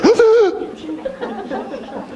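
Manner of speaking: high mock gasp of delight. Speaker marvels at kids coming to church, high mock gasp. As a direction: gasp in fake shock.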